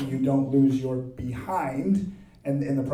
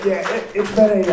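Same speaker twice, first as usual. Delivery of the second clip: worn-out hoarse voice after a weekend; 5 semitones higher, 8 dB louder than usual. Real, not acted. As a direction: talk with a lost, hoarse voice. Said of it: "I've lost my voice".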